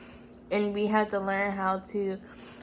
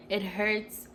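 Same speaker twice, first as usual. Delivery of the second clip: ordinary delivery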